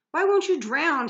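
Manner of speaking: high, loud anguished wail. A narrator's reading. Nearest wailing at Percy as he fails to drown him. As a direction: wail it out at the top of the voice. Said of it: "he wailed".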